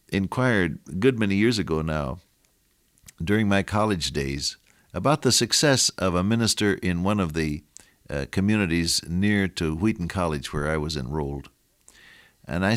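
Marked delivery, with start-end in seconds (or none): none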